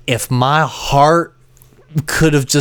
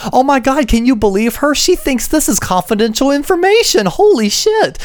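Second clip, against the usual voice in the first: much higher, gleeful disbelief; laughing at the landlady's answer in disbelief; incredulous aside; 11 semitones higher than usual. Retold or imitated. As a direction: squeal it out in disbelief. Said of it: "And I went".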